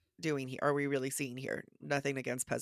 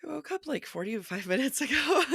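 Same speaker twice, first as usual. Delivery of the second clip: laughing; 10 semitones higher, 4 dB louder than usual. Real, not acted. Laughing about voice.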